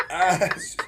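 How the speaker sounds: ordinary talk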